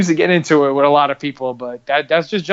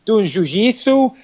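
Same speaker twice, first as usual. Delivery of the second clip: put-on Brazilian-accented "jujitsu", zh at both starts; mock Brazilian accent; 7 semitones higher than usual. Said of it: "hardcore Brazilian accent".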